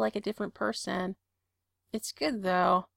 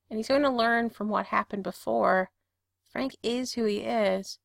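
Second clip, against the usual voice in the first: ordinary delivery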